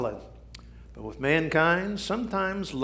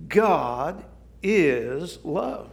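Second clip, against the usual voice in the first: slow emphatic quote; much slower than usual. Real, not acted. Scripture quoted slowly.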